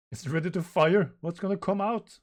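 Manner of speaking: high, loud dramatic announcement; excited battle cry. Acted. Narrator acts out a robot ready to fire, excited. Acting out manga battle dialogue.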